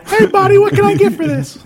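put-on very high-pitched little-kid voice; seven-year-old kid. Little kid trying to pawn something, high-pitched and childlike. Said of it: "Just like a seven-year-old".

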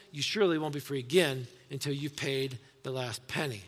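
loud, urgent reading of the verses; preacher reading scripture with rising force. Acted. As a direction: proclaim the passage to the church. Reads the passage aloud.

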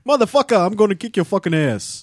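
mock New York accent. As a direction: threaten in broad New Yorkese. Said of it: "in that accent".

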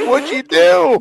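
high, loud, yelped question; hammed-up yelp; movie quote. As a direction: blurt it in a shrill character voice. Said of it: "and he goes".

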